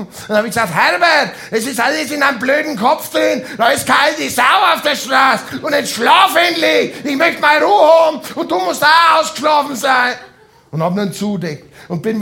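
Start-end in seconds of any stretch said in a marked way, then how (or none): 0.7-10.1 exasperated shout. Retold or imitated. Fed-up carer snaps at Herbert.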